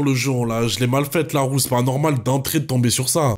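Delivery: usual speaking voice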